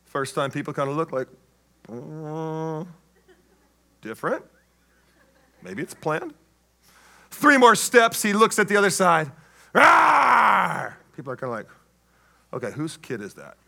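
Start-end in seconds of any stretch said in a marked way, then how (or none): 1.9-6.3 low, hushed, hesitant aside; puzzled guests. Acted. Puzzled wedding guests voiced. 9.7-10.9 drawn-out roar tailing down; mock bear roar. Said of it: "you were growling at everybody". 12.5-13.6 very soft, low aside; guest's mutter. Acted. Guests muttering about the boy.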